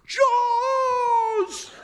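very high, loud, long held shout; terrified scream. Acted. Swimmer yanked under thinks a shark got him, terrified scream.

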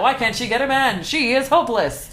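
high-pitched judgmental gossip; hammed-up pitying scorn; catty mock dismay. Mocking women in old shaving ad.

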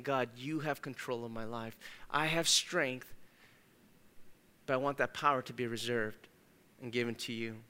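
low, soft, humble plea to God; soft surrendering prayer. Retold or imitated. Believer surrenders his strength to God, soft and humble. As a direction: pray it quietly, giving it all up.

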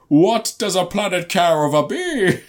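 high, slow, wailing mock villain; Orson Welles. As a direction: build to a loud, hammed-up held cry. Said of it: "we can watch Orson Welles go".

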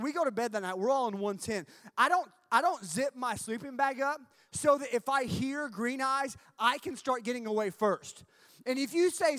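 ordinary talk

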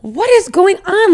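baffled by all the attention; puzzled. Retold or imitated. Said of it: "and I'm like".

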